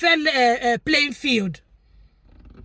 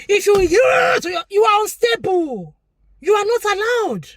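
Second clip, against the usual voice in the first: higher, slow, shouted warning; emphatic shout; 6 semitones higher, slower than usual. Real, not acted. Vlogger warning wife-beaters, slow and shouted. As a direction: drag each word out high and hard. Ranting against hitting people.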